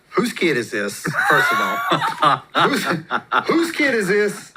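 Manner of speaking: laughing through a baffled question; half-laughing, asking whose kid this is; laughing disbelief. Retold or imitated. To his wife, laughing at the strange kid.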